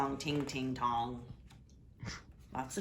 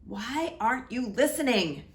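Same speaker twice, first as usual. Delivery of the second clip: louder, higher frustrated demand; the king shouting at the boy; angry shout; 7 semitones higher, 5 dB louder than usual. Acted. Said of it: "shouted the king".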